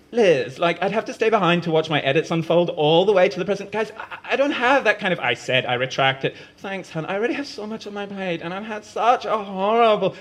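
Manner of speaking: high, fast, flustered outpouring; overwhelmed rant. Acted. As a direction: spiral into stressed venting. Umeko's rising overwhelmed rant.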